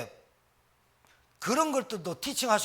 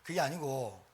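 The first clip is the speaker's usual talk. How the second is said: ordinary delivery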